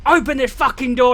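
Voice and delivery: loud, high angry yell; retold rage. Kid brother kicking the door shut by sisters, retold furious yell. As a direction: bellow it like a kid in a tantrum. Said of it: "anger rage as a kid".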